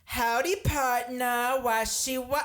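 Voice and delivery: high, hammed-up cowboy greeting; cowboy greeter. Buffet greeter dressed as a cowboy to guests, high hammed-up cowboy voice. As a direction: imitate a costumed greeter's high call. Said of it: "he's dressed as a cowboy".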